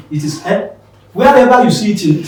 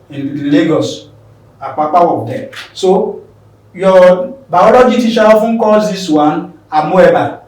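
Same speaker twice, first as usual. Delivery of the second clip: ordinary talk, nothing marked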